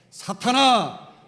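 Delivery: loud, slow, drawn-out cry; Jesus turns and shouts at Peter, stern and slow; stern rebuke. Acted. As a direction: call out the word like a commanding rebuke.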